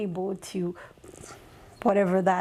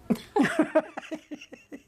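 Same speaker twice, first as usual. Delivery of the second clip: real, bright burst of laughter; laughing; 6 semitones higher than usual. Real, not acted. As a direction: laugh it off freely. Laughing at her own story.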